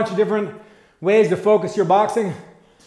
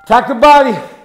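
loud, sharp coach call over music; shouted command; 3 semitones higher, 9 dB louder than usual. Real, not acted. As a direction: call it out to the class. Coach calling out boxing commands.

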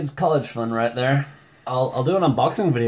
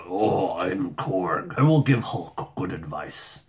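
lower, slow, solemn stone-man voice; brooding stone giant; 6 semitones lower, slower than usual. Retold or imitated. Vlogger imagines how Korg should sound, low slow brooding giant. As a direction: rumble it out like a wise rock gladiator. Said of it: "you expect him to sound like that".

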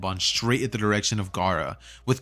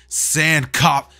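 loud, higher cut-off attack call; jutsu shout; 6 semitones higher, 6 dB louder than usual. Acted. Gaara calling his sand attack, loud. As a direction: shout the technique. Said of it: "as Gaara would say".

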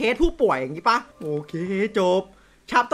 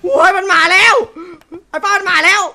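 higher, louder panicked yell; alarmed shout; 7 semitones higher, 6 dB louder than usual. Real, not acted. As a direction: yell that it's coming. Monster arriving, yelling.

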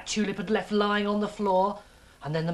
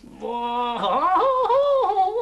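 long, high, loud gleeful whoop; loud rising "whoo" of delight; 13 semitones higher, 5 dB louder than usual. Acted. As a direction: whoop as if on a fun ride. Ernie enjoys the water.

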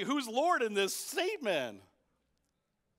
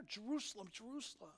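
much softer, fading lament; 9 dB quieter, faster than usual. Retold or imitated. Jesus grieving over Jerusalem, soft lament. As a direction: let the name sink in grief. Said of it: "Can't you feel the emotion there?".

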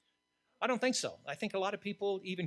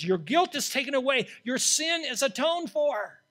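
loud, higher, slow start; preaching at full force; 9 semitones higher, 13 dB louder than usual. Acted. Preacher voicing Isaiah's confession, preaching at full force. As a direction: cry it out from the pulpit. Voices the angel's pardon.

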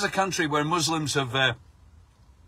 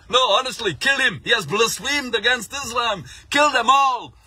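mock Hamza; 7 semitones higher, 3 dB louder than usual. Mock voicing Hamza's other side.

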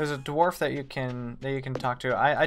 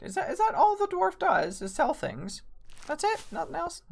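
much higher, incredulous squeak of dismay; can't believe that's all he does; disappointed disbelief; 14 semitones higher than usual. Real, not acted. Disbelief the dwarf only sells.

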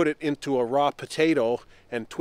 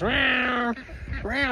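high mock meowing cry; imitating the cat crying, pitched up; imitated cat cry; 8 semitones higher than usual. Imitating the crying cat for viewers, high mock cry. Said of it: "It comes".

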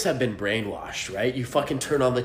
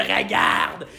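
"regarde" growled low like a monster from deep in the throat; 10 semitones lower, 3 dB louder than usual. Shown as a demonstration. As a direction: snarl "regarde" like a beast. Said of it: "you gotta act like a fucking monster".

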